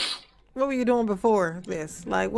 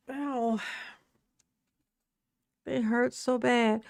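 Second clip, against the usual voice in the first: very slow, higher, stretched-out whine; whiny complaint; 3 semitones higher, slower than usual. Real, not acted. Complaining about sore shoulders.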